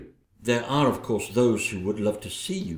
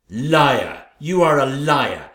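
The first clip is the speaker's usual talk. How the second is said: loud accusation; 6 dB louder, slower than usual. Acted. Queen shouting down her rival, loud and accusing.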